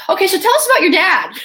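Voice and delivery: high, loud, chirpy prompt; high put-on interviewer question; mimicked interviewer prompt.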